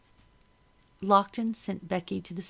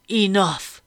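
loud commanding boom; a master's booming order; 9 dB louder than usual. A narrator's reading. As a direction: stop the room with one word. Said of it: "Lockton's loud voice boomed".

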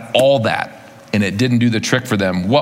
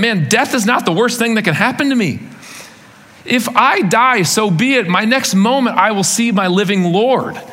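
inspired thought; 8 semitones higher than usual. Retold or imitated. Disciples' inner thought, voiced.

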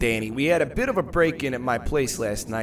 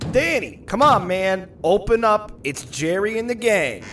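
calling out; 7 semitones higher, 5 dB louder than usual. Acted. Calling at the castle door.

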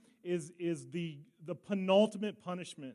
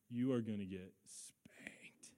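hushed, slow church whisper of a threat; a parent's whispered warning at church; 9 semitones lower, 13 dB quieter, slower than usual. Retold or imitated. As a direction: hiss the warning under your breath in the pew.

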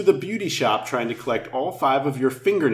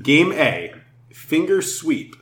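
ordinary talk

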